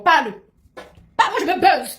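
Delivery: loud, high taunt with a laugh; taunting shout. Real, not acted. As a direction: dare her to speak, mockingly. Taunting rival to talk about her.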